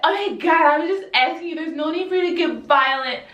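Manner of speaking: high, hammed-up protest; mock offended show. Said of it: "they gonna put on a show".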